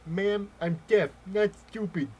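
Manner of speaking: very loud, high, drawn-out put-on voice; hammed-up deaf man protesting; mock deaf voice. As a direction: pretend you can't hear how loud you are.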